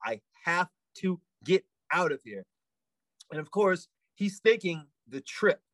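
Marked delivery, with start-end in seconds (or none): 0.0-2.4 "I have to get out of here" spaced out word by word; firm, measured "I have to get out of here," with gaps between words; very definite. Retold or imitated. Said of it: "it was a very definite".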